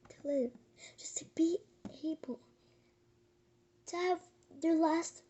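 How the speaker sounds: breathy whisper on a sad thought; soft whispered aside in a sad story. Real, not acted. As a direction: let the words drop to a whisper. Hushed aside while retelling tragedy.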